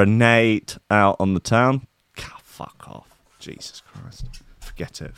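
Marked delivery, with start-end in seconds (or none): 2.2-5.2 whispered exasperation. Real, not acted. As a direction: breathe it out, unvoiced. Whispered frustrated muttering.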